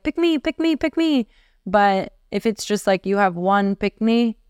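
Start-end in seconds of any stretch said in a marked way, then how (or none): 0.0-1.3 put-on children begging to be picked, pitched high; eager kids. Wife imitating children begging to be chosen, high eager chant. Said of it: "'cause they're all like".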